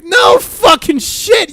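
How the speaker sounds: loud, high, drawn-out outburst; exasperated shout. Acted. Mocking someone's slow realisation, exasperated shout. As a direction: yell it slowly in exasperation. Exasperated retort to quoted remark.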